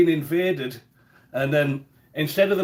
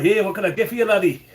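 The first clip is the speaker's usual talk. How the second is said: higher-pitched Glasgow Scottish greeting; broad Scottish accent; 3 semitones higher than usual. Retold or imitated. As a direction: imitate a broad Scottish newsagent. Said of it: "a broad Scottish accent".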